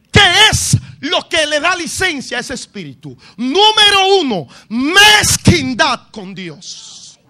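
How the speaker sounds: slow, loud shouted preaching; preacher warning the congregation loudly; preaching at full force. Acted. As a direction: hammer the point home. Preaching list of curses.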